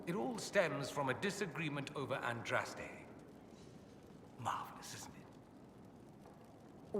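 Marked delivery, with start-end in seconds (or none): 4.4-7.0 dry sarcastic whispered aside. Acted.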